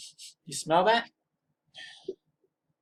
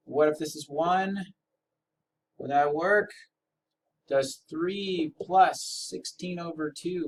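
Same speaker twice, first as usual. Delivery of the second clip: normal talking voice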